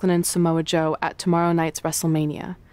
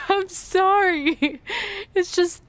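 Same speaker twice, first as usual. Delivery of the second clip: giggling through a slow, high sorry; sorry, half laughing; laughing apology; 11 semitones higher, slower than usual. Real, not acted. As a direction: apologize while still cracking up. Laughing apology after teasing.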